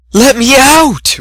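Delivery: loud high slow howl. Acted. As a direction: howl from behind a door. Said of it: "Rarity howled".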